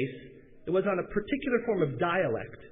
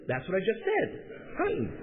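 higher Southern voice, dropped g; southern accent; 6 semitones higher than usual. Retold or imitated. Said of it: "said they were hunting instead of hunting".

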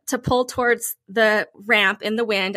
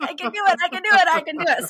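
self pep talk; 6 semitones higher, faster than usual. Retold or imitated. Pep talk to herself before docking.

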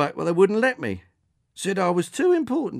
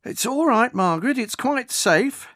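reassuring delivery man; 10 semitones higher, 3 dB louder than usual. Acted. Delivery man reassuring the wary old woman, calm. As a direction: soothe her nerves about the drone.

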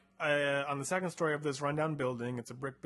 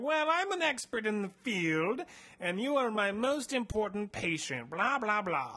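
higher put-on mad scientist voice; creepy doctor; 6 semitones higher than usual. Doctor Nair asking the captive about genetic engineering, higher sinister voice. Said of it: "he's like".

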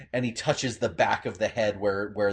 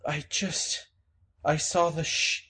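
higher, shaken confused voice; dazed Gerald; 8 semitones higher than usual. Acted. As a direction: stammer out the memory, frightened. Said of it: "Gerald is just gasping".

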